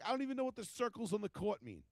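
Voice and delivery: high-pitched mock-argument voice; retold protest. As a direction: protest it as if re-enacting a bar argument. His retold side of the argument.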